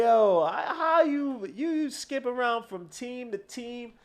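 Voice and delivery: high, loud mock voice of the poster; mocking a rival fan's question, high and loud; mocking quote. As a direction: ham up his words. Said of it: "He said".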